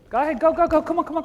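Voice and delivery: loud, high go-go yelling; urging shout. Real, not acted. Coach sending players off, shouting loud and high. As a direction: get them moving now.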